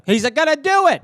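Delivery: mock fearful cat; a hint of a jumpy cat; paranoid cat. Cat spotting owner entering room, mock fearful. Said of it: "he's like".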